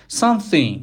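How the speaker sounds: loud exaggerated practice. Shown as a demonstration. Teacher demonstrating the exaggeration technique, loud and emphatic. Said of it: "کلمات رو با صدای بلند بخونید".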